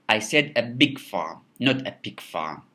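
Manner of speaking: big punched against pig; contrastive stress on BIG. Shown as a demonstration. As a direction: correct a mishearing by hitting the word big. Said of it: "when we stress big".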